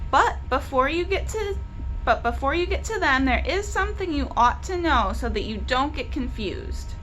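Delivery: plain reading voice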